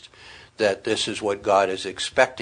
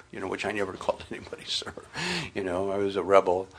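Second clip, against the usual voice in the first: whispered aside; 5 dB quieter, much faster than usual. Real, not acted. Aside about never saying sir.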